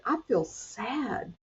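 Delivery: soft self-talk. Shown as a demonstration. To herself when stressed, slow soft acknowledgment. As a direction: calmly say it to yourself. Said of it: "I just say".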